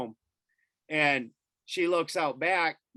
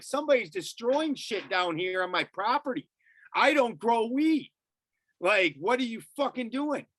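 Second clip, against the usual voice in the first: high-pitched indignant protest; retold screaming; 8 semitones higher than usual. Landowner answering a cop on his property, high indignant protest. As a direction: re-enact outraged arguing, pitch climbing. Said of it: "screaming at 'em".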